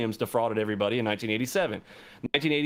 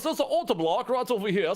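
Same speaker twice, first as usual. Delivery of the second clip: bad English accent; 7 semitones higher than usual. Retelling Dante's complaint about CT, in a high, bad English accent. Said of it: "terrible doing an English voice".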